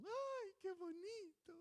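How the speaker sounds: cooing adults. Retold or imitated. Adults cooing over a baby.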